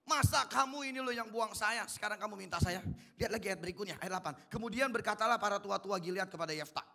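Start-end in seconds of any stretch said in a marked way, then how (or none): 0.0-2.8 higher and louder, indignant refusal; angry Jephthah. Acted. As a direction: throw the refusal back at them. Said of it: "Yefta marah, makanya Yefta bilang".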